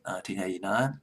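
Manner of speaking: normal talking voice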